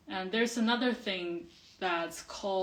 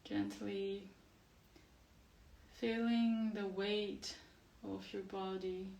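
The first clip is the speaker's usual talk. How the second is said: guided meditation; 8 dB quieter than usual. Acted. Teacher settles class with eyes closed, guided meditation. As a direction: gently guide them into the body, softly and unhurried.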